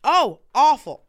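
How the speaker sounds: emphatic disgust. Real, not acted. Recalling a bad date.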